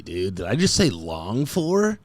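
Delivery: ordinary talk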